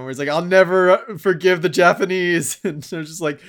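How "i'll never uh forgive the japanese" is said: loud, higher mock vow; Joseph Joestar. Friend quoting a meme of an anime hero, loud mock indignation. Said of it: "where that meme came from".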